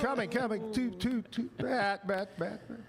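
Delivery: high announcer call, each word echoed; stadium PA echo. Shown as a demonstration. Host showing how to PA a stadium game, echoing. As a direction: repeat each word like echoes fading round a ballpark. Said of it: "You have to echo everything you say".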